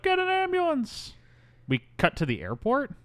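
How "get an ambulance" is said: high-pitched quoted cry; mock emergency call. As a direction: squeal it as a mock call for help. Acts out the panicked call.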